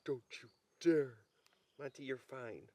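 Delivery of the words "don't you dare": a grumpy dog warning its owner off; huffy "don't you dare" for the dog; dog's voice. Pretend. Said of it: "Look at him, he's like".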